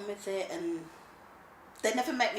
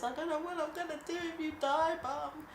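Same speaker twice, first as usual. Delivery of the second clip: put-on much higher, childlike plaintive voice; imitated worried son; 10 semitones higher than usual. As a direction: mimic a fretting child, pitched way up. Mother voices her son's worry.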